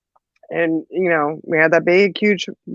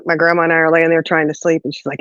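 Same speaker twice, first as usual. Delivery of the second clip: ordinary talk, nothing marked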